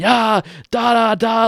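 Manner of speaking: mock cursing. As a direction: imitate a rant with nonsense syllables. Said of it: "started cussing and cursing".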